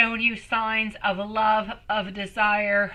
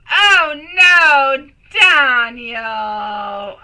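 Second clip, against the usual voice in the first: dismayed cry; 4 semitones higher, 10 dB louder, much slower than usual. Real, not acted. Reacting to viewer's sad news.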